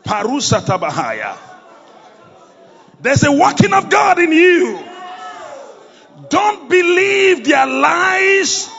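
loud, ringing sermon delivery; full-force preaching. Acted. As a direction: preach it out with fire.

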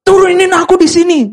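high-pitched mock outburst; quarrelling girlfriend. Girlfriend fighting with boyfriend in the car, high mock outburst. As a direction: play the girlfriend demanding to get out. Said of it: "eh, mereka berantem. Terus ceweknya bilang apa?".

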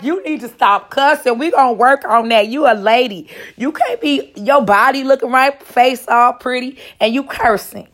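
fussing, playful reproach; mock scolding. He scolds her for cussing.

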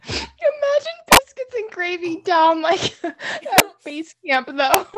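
high, squeaky talk through laughter; laughing. Real, not acted. Co-host picturing gravy at base camp, cracking up. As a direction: barely get the words out for laughing.

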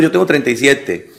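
ordinary talk, nothing marked

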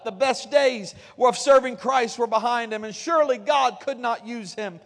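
ordinary delivery

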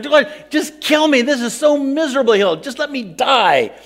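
high and loud, slowing at the end; whining outcry. Retold or imitated. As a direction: beg God to end it, loud and whiny. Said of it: "And again, I whined".

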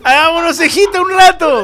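high, loud, gleeful call; playful invitation. Retold or imitated. Mocking the airport guy, loud gleeful invite. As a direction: belt it out as a cheeky dare.

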